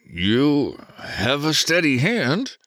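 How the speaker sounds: slow words through clenched teeth; through gritted teeth. A narrator's reading. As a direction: speak through your teeth. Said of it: "through his teeth".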